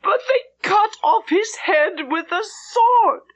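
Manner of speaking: high, tearful voice breaking into sobs; sobbing with shock. A narrator's reading. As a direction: gasp it out through shocked crying. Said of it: "almost incoherent with grief and shock".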